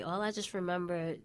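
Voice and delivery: ordinary talk, nothing marked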